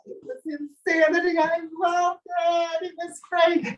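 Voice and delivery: high, delighted exclaim; thrilled. Real, not acted. Recalling the screaming witches, high and thrilled. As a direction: gush with delight.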